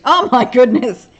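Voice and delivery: high, loud burst of surprise; amused surprise. Real, not acted. Host reacting to being told she sounds British, amused surprise. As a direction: exclaim it in amused disbelief.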